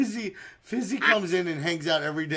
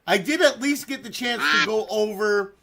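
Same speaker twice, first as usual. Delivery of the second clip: normal talking voice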